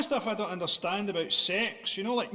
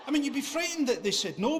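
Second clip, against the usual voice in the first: normal talking voice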